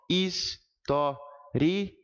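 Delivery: his-to-ri-a in four slow, separate syllables. Shown as a demonstration. Teacher splitting the final diphthong into a hiatus, his-to-ri-a syllable by syllable. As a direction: break his-to-ri-a apart with a gap after each syllable. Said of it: "eu posso até separá-lo, ficando da seguinte forma".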